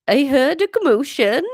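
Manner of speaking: put-on high, loud Welsh boy, voice breaking; old-ladyish cracking teen Welsh; breaking teenage Welsh. Younger son explains himself, breaking teenage Welsh voice. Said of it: "teenage Welsh, so it's breaking up".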